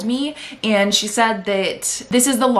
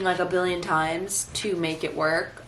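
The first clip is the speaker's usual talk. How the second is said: ordinary talk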